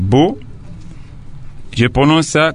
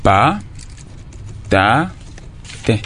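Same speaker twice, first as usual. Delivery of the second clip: "pa, ta, te" spaced out slowly, one beat each; teacher demonstrating "pa, ta, te" syllable by syllable; syllables read calmly; 5 dB quieter, slower than usual. Shown as a demonstration. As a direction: break "patate" into slow separate syllables. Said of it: "Je lis calmement".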